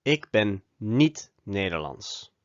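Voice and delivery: "Ik ben niet Nederlands" slowly and clearly; slow model phrase. Shown as a demonstration. Teacher models the sentence slowly for learners. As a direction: spell it out in sound, slowly. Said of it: "How would you say".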